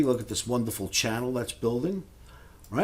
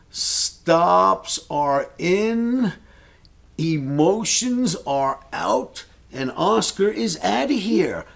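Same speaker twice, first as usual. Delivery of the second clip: drawn-out slogan; 6 semitones higher, 4 dB louder, much slower than usual. Real, not acted. Signature sign-off slogan.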